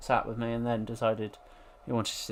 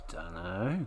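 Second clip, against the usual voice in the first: usual speaking voice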